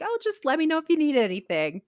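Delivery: high, airy imitation of a casual offer; mock breezy offer. Friend making a vague offer of help, mock breezy. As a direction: toss it off as an empty nicety.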